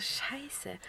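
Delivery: whisper. Retold or imitated. Seeing whose glasses they were, whispered curse. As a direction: hiss it under your breath. Inner reaction to the broken glasses.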